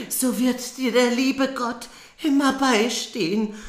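high, hammed-up dying mother with fake coughs. Mother on her deathbed speaking to her daughter, high and coughing. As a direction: play the frail dying mother, coughing between words.

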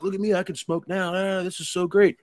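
high-pitched gloating imitation; high, silly mock boasting; mock bragging. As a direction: mimic a friend showing off, voice way up. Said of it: "Magoo's talking all this shit".

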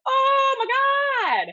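high, loud dramatic exclamation; big retold "can't believe it"; retold shock. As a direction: recreate the horrified reaction at a high pitch. Retold shock on seeing the film.

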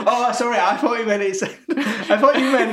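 high-pitched, talking through laughter; laughing. Real, not acted. Co-host cracking up over a misheard lyric, laughing. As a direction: crack up mid-sentence, voice lifted.

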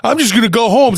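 imitating a fed-up cousin storming off; mock huff. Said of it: "So he got mad".

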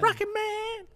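squeaky high-pitched Rocket Man; high goofy echo of the nickname; silly high Rocket Man. Pretend. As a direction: toss it off in a cartoon-high voice. Repeating Rocket Man in funny voice.

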